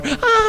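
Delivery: very high, thin held scream; all-out mock scream. Sailor jumps off on blue screen, mock scream. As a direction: give the pretend scream your all, squealing high. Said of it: "You scream like it's your job".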